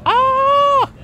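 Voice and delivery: a high, delighted scream of joy; excited squeal. Real, not acted. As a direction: let out a thrilled high-pitched yelp. Excited at the Jaguar.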